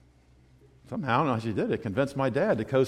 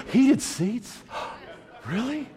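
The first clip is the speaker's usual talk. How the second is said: mock delighted surprise; 10 semitones higher, much slower than usual. Mother voiced in story.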